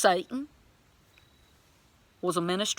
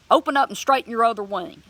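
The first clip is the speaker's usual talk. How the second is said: loud, quick, higher-pitched order; commanding; 4 semitones higher, 7 dB louder, faster than usual. Retold or imitated. Believer ordering the bird's other wing open, urgent commanding. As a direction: fire off a firm command. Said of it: "started commanding the bird".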